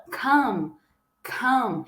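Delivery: "come" said as "câm", short neutral vowel; pronunciation demo. Shown as a demonstration. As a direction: model "come" twice, clear and short. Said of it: "Vocês vão pronunciar dessa forma assim". ("come" repetido como "câm")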